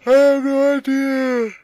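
very high, loud, slow shriek; loud high drawn-out cry; silly shout. Pretend. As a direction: blurt it out as a high squeal. Sudden silly outburst.

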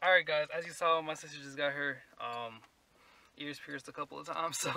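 normal talking voice

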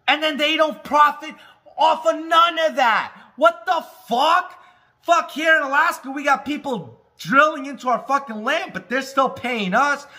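loud, high, furious ranting; shouting at the NFL owners in outrage; angry rant. Real, not acted. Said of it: "that's just me getting upset".

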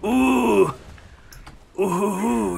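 high, loud drawn-out oohs; Viking whoops. Pretend. Player back in Viking voice, high mock whoops. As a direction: whoop like a pretend raider.

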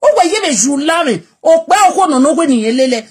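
loud escalating scolding; heated rant. Real, not acted. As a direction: tear into Yuri.

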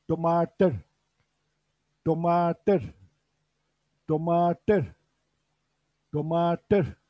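Domatır said slowly and clearly, each syllable even; Urdu pronunciation. Shown as a demonstration. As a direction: teach Domatır like a model word for students. (Domatır kelimesini öğretir gibi tane tane söyle)

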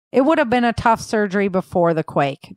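plain reading voice

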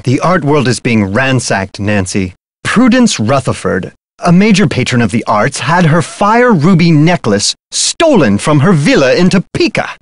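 ordinary talk

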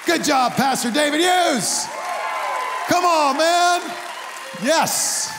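loud, high cheer for the pastor; celebratory shout to the crowd. Real, not acted. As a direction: whoop it up for him.

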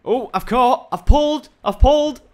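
high, loud triumphant cries; excited shouting. Real, not acted. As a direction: shout out the catch, thrilled. Suddenly catches a fish.

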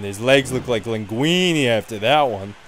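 ordinary talk

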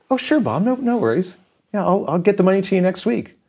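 high, breezy imitated voice; obliging English distributor. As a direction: agree readily, pitched well up. English distributor voiced, agreeing readily.

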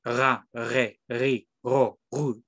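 Ra, re, ri, ro, ru with a throaty French R; French R syllables. Shown as a demonstration. Teacher drilling students on French R syllables. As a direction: growl each R syllable from the throat. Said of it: "se pronuncia R".

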